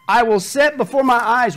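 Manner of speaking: loud emphatic reading; scripture reading. Acted. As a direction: read it out with weight. Scripture read aloud.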